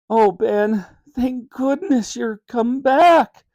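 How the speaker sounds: high, slow wailing relief; a mother wailing with relief. Acted. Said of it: "wailed Mum".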